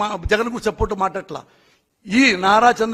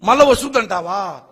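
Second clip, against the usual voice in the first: usual speaking voice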